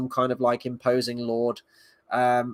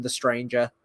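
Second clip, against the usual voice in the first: normal talking voice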